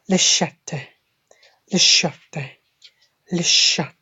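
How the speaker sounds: "le chat" said "la shat", with the T released; French feminine form. Shown as a demonstration. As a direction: drill "le chat" as sha-tuh, T sounded at the end. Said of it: "and ch, a, t, e".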